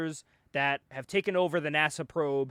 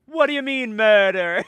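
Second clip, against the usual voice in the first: loud mock indignant quote; quoting the meme video again, loud mock indignation; 5 semitones higher, 8 dB louder than usual. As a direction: repeat the meme line louder. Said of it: "You seen that video?".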